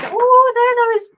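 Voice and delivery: high hammed-up surprise; mock amazed exclamation. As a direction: play the listener spotting the bugs, all gasp and wonder. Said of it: "You go".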